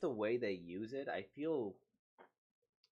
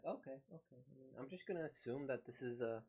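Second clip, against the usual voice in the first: ordinary delivery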